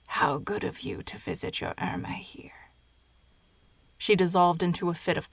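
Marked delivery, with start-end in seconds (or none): 0.1-2.7 frail, softer hoarse whisper. A narrator's reading. Frail grandmother welcoming her visitor, hoarse whisper. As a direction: rasp it out like a dying woman. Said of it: "she said in a hoarse voice".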